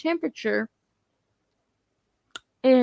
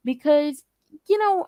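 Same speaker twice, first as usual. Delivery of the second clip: ordinary talk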